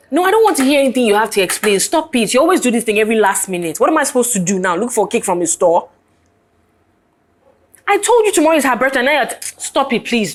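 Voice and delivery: angry scolding. Acted. Customer scolds baker over tomorrow's cake, angry. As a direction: cut off the excuses, fed up.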